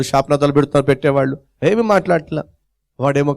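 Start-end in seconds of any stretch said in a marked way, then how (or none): none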